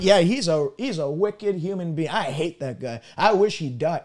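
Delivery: low, soft, spiteful mutter of a grudge-holder; bitter grudge voice. Retold or imitated. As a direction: sink low and quiet, nursing old hatred.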